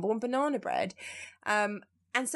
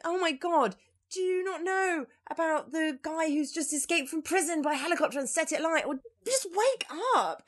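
incredulous; 8 semitones higher than usual. Retold or imitated. Mocking oblivious friends.

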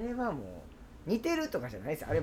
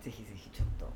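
whisper; 9 semitones lower, 9 dB quieter, faster than usual. Acted. Whispered aside in a joke plea.

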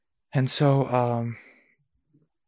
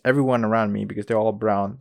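normal talking voice

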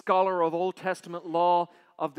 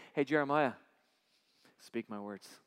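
voice of God; 5 semitones lower than usual. Acted. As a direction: call him gently from below. God calling Jeremiah.

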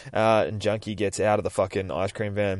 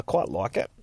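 ordinary talk, nothing marked

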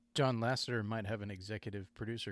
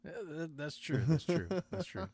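mock eager agreement; 7 semitones higher than usual. Mock-agreeing, repeating that's true.

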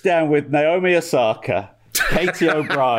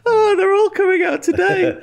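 mock high host; 3 dB louder than usual.

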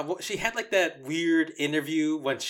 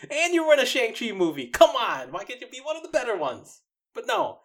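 exasperated; 8 semitones higher, faster than usual. Real, not acted. Scolding the absent actress.

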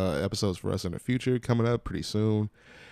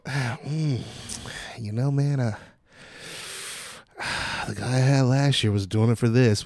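lightly voiced hesitant customer, sighing; a hint of a reluctant haggler; 3 semitones higher than usual. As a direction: hem and haw like a customer angling for a discount.

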